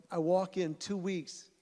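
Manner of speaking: usual speaking voice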